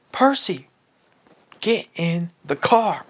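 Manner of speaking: high, loud call with a falling tail. Acted. Dad calling his son into the truck, urgent call. Man pulls up in truck, calls.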